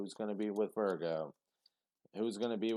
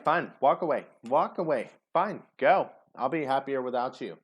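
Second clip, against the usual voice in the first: bitter dismissal; 4 semitones higher, 8 dB louder than usual. Retold or imitated. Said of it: "it's like".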